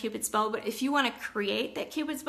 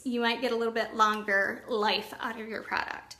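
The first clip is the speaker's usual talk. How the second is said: normal talking voice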